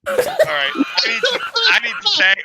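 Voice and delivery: high, loud laughing plea. Real, not acted. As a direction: crack up while asking out. Laughing, begging to leave.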